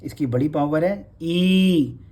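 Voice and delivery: teacher stressing the long ई; long vowel. Shown as a demonstration. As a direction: stretch the big ई slowly. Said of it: "Bihari बड़ी".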